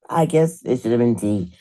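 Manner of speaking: normal talking voice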